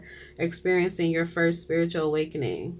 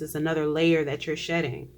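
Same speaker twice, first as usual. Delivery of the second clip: ordinary talk, nothing marked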